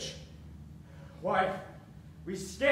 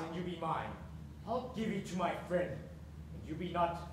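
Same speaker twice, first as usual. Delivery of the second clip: quiet menace; 7 semitones lower, 8 dB quieter than usual. Acted. Father's quiet menacing ultimatum.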